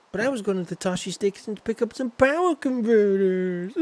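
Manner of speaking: put-on high, whining teenage protest; whiny Luke. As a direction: complain like a sulky farm boy. Acting Luke's whiny farm complaint.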